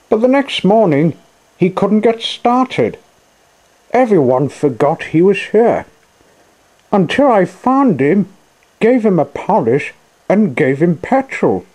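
very high-pitched character voice; Jenny's storytelling voice. A narrator's reading. Jenny explains Coco's past to the machines, high-voiced. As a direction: tell the tale in a high little voice.

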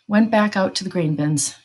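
plain reading voice

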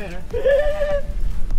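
high laugh. Real, not acted. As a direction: burst of high squealing laughter. After imitating father.

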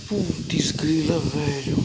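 slow amazed whisper; awed whisper. Real, not acted. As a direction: breathe it out in slow awe. Awed at the gift. (sussurro lento de espanto)

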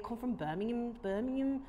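softly practised Brummie; Birmingham accent. Shown as a demonstration. Host coaching herself into a Birmingham accent. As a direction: find the Birmingham accent, quietly. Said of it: "do it in a Brom accent".

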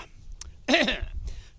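pompous cough before the letter; mock throat-clearing cough. Father clearing his throat before the mock letter, pompous cough. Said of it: "By letter".

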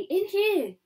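high, loud hiding call; urgent command. Acted. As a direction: usher friends in hurriedly. Urging friends to hide.

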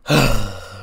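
heavy exasperated sigh, pushed out loud; Manny sighing at Reggie's evasions, loud weary sigh. Retold or imitated. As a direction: let out a long fed-up sigh. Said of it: "Manny just sort of sighs".

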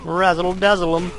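showman voice. Retold or imitated. Echoes game character's line.

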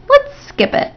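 high, airy brush-off; breezy dismissal. A narrator's reading. Stuart proposing to skip arithmetic, high and breezy. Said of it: "snapped Stuart".

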